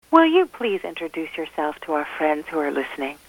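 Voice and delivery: ordinary talk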